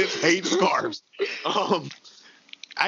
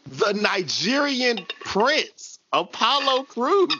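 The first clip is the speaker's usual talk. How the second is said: drawn-out, high-pitched name announcement; announcer introduction; 6 semitones higher, much slower than usual. Pretend. Podcast host introduces a wrestler, drawn-out announcer style. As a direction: stretch out each word of the title.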